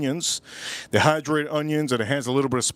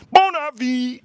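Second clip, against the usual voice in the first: mock French accent, higher and louder; 9 semitones higher, 4 dB louder, slower than usual. Fake French accent greeting the viewers. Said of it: "mock French sign-off".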